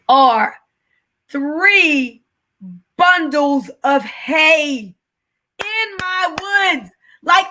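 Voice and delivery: emotional, emphatic disbelief; getting emotional. Real, not acted. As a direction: overcome with wonder, stretch out each word. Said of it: "I'm getting emotional about this now".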